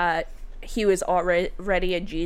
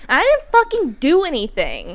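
higher, louder burst of disbelief; incredulous, raised-voice disclaimer; exasperated protest; 7 semitones higher, 7 dB louder than usual. Real, not acted. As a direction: protest loudly, pitch jumping up. Protesting his claim she saved him.

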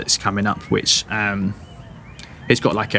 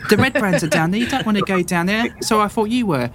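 put-on high, excited little boy boasting; a kid bragging to adventurers; eager farm boy; 7 semitones higher than usual. GM keeps voicing boy.

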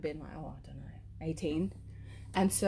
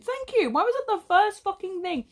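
indignant; 10 semitones higher, 6 dB louder than usual. Retold or imitated. Indignant retort to mum.

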